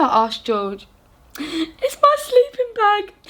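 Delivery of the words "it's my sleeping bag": higher, put-on sad fox; a crying fox telling the giant about his bag, high and sad. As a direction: speak as a tearful little fox. Crying fox answers George.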